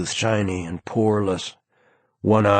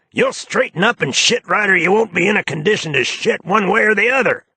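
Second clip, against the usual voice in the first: much higher, fast, camp tirade; A J scolding; 12 semitones higher, 4 dB louder, faster than usual. A narrator's reading. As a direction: rattle off a furious threat at the baboon. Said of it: "A J".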